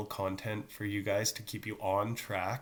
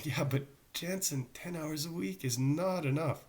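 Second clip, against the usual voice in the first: higher-pitched mock objection; complaining parents; 6 semitones higher than usual. Teacher voices parents wanting more hours, mock complaint. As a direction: lightly imitate a parent protesting, voice raised in pitch. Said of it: "a lot of people are saying".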